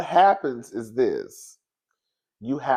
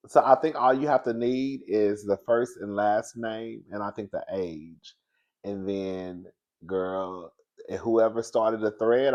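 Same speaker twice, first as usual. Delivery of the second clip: ordinary delivery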